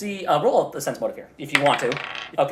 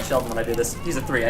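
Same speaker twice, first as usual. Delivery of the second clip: ordinary talk, nothing marked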